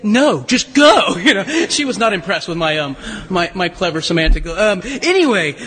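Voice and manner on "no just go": slow, louder mock-motherly dismissal; drawn-out, emphatic retort in a mother's voice; exasperated mother. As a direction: imitate a mother cutting off the argument. Mother unimpressed by child's correction.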